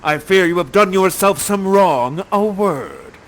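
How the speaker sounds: loud, commanding father; Prospero's stern word. Acted. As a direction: confront the young man. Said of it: "He shows up and says".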